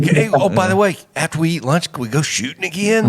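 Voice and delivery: mock-casual angling. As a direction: slip in the request like an afterthought. Acting out angling for another outing.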